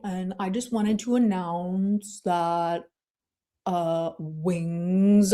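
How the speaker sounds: slow, drawn-out put-on voice; Nicolina. Streamer showing off an impersonation of an announcement, slow drawn-out voice. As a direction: impersonate her announcement, stretching out the words. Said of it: "I can do Nicolina's really well".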